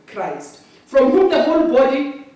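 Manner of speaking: usual speaking voice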